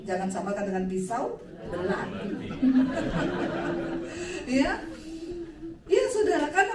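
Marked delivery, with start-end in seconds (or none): 2.3-4.4 long bright laugh; real laugh after the joke. Real, not acted. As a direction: burst into laughter.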